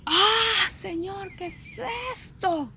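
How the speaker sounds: high, strained cry out to God; re-enacted desperate wail, drawn out at the end; retold anguished cry. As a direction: cry out in high-pitched distress, voice thinning after the first burst. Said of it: "yo grité, yo dije".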